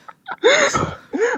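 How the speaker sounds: hearty laugh at her own joke; big high laugh. Real, not acted. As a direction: let a real laugh break out high.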